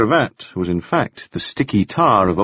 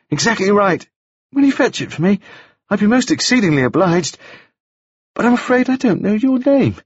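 put-on much higher, bright boyish voice; high bright young gentleman; 11 semitones higher than usual. A narrator's reading. Wounded Staines greeting Taufari, high and bright. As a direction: lift it up high and bright like a dazed young toff. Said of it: "speaking in a voice that was high and very bright".

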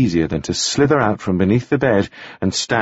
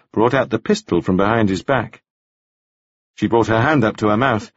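usual speaking voice

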